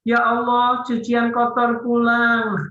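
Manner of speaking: high, drawn-out mock lament; dismayed wife. Wife seeing her husband come home listless, high slow dismayed voice. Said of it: "istri buka korden".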